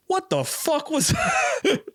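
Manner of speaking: high, slow, drawn-out what the fuck; stunned disbelief. Retold or imitated. Driver reacts to bizarre passenger.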